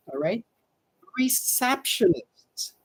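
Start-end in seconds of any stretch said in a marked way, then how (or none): 1.2-2.7 "Receptionist" said slowly, re-SEP-tion-ist; slow, careful "Receptionist" for learners; slow model pronunciation. Shown as a demonstration. Teacher slowly modeling receptionist for the class.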